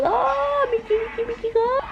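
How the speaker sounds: very high cooing. Real, not acted. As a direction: coo high at the dog. Baby talk to dog.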